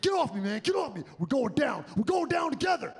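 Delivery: a mule yelling as it goes down; panicking mule. Retold or imitated. Mule yelling at the rider, in a shouted panic. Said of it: "He's like".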